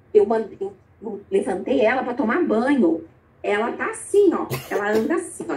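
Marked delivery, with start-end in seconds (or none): none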